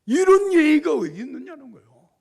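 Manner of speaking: high, loud outraged bark; a general shouting at his captain; furious general. Retold or imitated. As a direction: blast him for his rudeness. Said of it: "화를 불같이 내는 거야".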